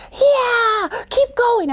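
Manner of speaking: very high, squealed encouragement; high-pitched whoop of delight; cheering parent. Acted. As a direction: cheer a toddler on. Acts out parent cheering baby on.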